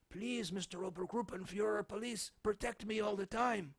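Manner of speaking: hammed-up begging for protection; frightened woman begging the police, mocking; mock cowering woman. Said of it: "make the woman go cower in fear".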